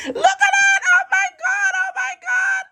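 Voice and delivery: piercingly high, repeated squeals; excited shriek. Real, not acted. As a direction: squeal it over and over at full voice. Shrieking over her table reveal.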